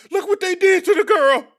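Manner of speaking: high-pitched, over-the-top fake concern; mock outraged sympathy. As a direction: imitate gullible people wailing over her. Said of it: "And people said".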